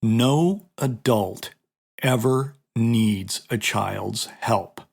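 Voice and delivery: very slow, word by word; deliberate, drawn-out teaching emphasis; emphatic repeat. Shown as a demonstration. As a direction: say it again slowly for emphasis, spacing out the words. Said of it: "I'm gonna say that again".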